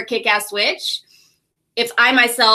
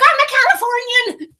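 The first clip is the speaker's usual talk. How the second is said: squeaky high self-mocking reply; high playful mock plea; high mock whine; 14 semitones higher than usual. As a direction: pitch it way up like a pouty kid. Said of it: "And you're like".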